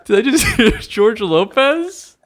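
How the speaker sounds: high, slow, laughing question; laughing disbelief. Real, not acted. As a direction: laugh in disbelief as you ask. Disbelief at absurd recording.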